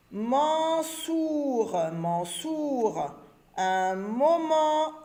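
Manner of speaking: moment drawn out slowly, syllable by syllable. Shown as a demonstration. Teacher reads un moment slowly to the pupils. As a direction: spell it out with your voice. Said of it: "reading demo".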